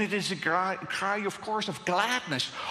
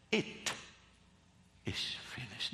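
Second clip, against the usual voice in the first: whispered; 7 semitones lower, 10 dB quieter than usual. Shown as a demonstration. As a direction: breathe "It is finished" as a hushed whisper. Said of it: "The full emphasis is not on that".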